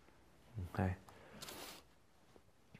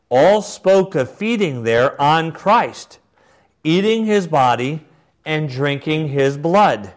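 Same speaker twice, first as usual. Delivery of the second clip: higher, louder, slow declamation; measured, emphatic oratory; formal quotation reading; 5 semitones higher, 5 dB louder than usual. Acted. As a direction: read it like a solemn proclamation. Reads bishop's words aloud.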